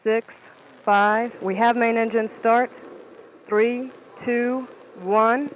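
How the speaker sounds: rising loud countdown to liftoff; excited launch call. Real, not acted. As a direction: build to a shout at liftoff. Commentator calling the liftoff.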